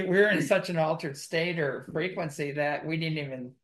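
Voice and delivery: ordinary talk, nothing marked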